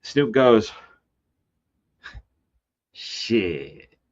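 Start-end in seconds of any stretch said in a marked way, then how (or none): 2.9-4.0 low, quiet, stoned. Retold or imitated. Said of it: "Just like that".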